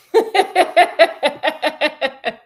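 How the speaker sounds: long, high cackle; real laugh. Real, not acted. Storyteller laughs at Scott's wrong guess, real high cackle. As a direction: burst into a rolling laugh.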